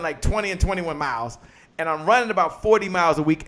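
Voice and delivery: usual speaking voice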